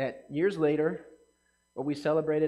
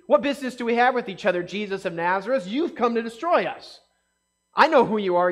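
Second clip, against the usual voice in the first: demon's retort; 4 semitones higher, 6 dB louder than usual. Acted. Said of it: "So it could be".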